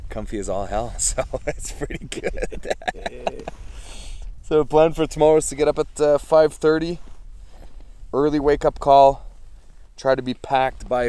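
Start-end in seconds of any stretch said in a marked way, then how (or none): none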